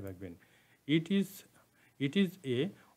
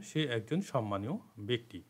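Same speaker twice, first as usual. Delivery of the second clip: ordinary talk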